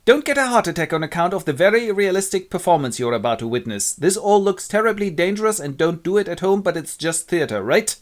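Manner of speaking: high, anxious stage disclaimer; amateur prologue speaker. Acted. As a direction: reassure the audience as a nervous amateur. Quince gives nervous disclaimer prologue.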